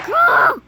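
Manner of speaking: sudden high yelp of frustration; frustrated yelp. Real, not acted. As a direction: yelp out as the bird crashes. Gamer crashes the bird again.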